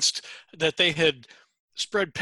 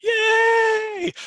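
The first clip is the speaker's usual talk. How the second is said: high shouted yay; excited cheer; 6 dB louder than usual. Real, not acted. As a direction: cheer it out loud. Cheering the show title.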